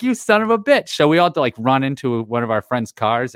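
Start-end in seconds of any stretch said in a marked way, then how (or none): 0.0-0.9 mock outrage. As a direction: curse the cousin in half-joking fury. Cursing the cousin who kept tape.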